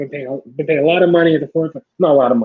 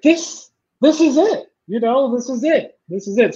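acted awe in a higher voice; thrilled realisation; 7 semitones higher than usual. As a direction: replay the moment it all clicked, pitched up. Acts his thrill at the production.

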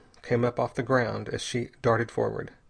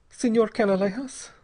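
put-on higher-pitched girl, drearily answering; dreary, higher voice for the girl; 9 semitones higher than usual. A narrator's reading. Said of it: "said the girl drearily".

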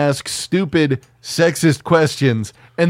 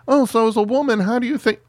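high, prissy mock reporter question; 9 semitones higher, faster than usual. Mocking the media asking a woman candidate, high mock reporter voice. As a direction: parody a clueless interviewer. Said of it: "what the media asks".

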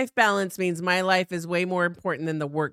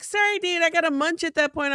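much higher, airy brush-off; breezy excuse; 10 semitones higher than usual. Pretend. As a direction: toss off the excuse lightly, pitched way up. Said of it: "I'll be like".

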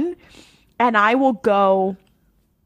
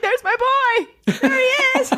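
much higher and slow, delighted, with a laugh; gushing fan; 13 semitones higher, slower than usual. Pretend. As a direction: squeal with joy at your favourite's return. Gushing over character's return.

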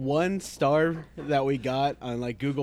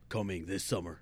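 low, quiet trailer voice; deep movie trailer narrator; 7 semitones lower, 11 dB quieter than usual. Pretend. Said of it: "the whole movie thing".

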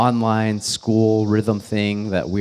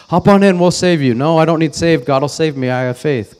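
joke voices; 5 semitones higher, 5 dB louder than usual. Retold or imitated. Retold flood joke, voiced rescuers.

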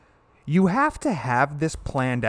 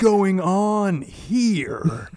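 very slow, higher, bewildered stoner drawl; stoner Keanu voice; 6 semitones higher, much slower than usual. Retold or imitated. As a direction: drag it out like a confused surfer. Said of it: "he just kept that stoner accent".

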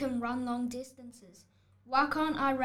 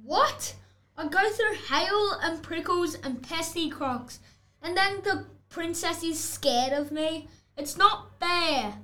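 outraged complaint; 3 semitones higher, 3 dB louder than usual. Acted. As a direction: whine that it's unfair. Wolf protests being pushed down.

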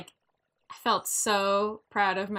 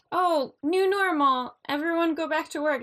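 mock cheerful announcement; 7 semitones higher, 5 dB louder than usual. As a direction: sing it out like a careless boss. Mocking eager return-to-work talk.

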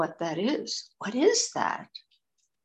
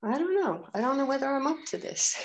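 doubtful; 6 semitones higher, faster than usual. Acted. Acting out the hindrance doubt.

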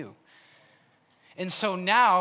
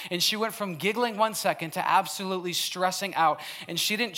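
ordinary talk

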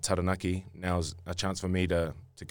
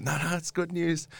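reassuring excitement; 11 semitones higher than usual. Retold or imitated. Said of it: "I'm like".

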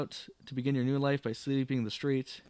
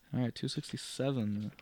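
ordinary delivery

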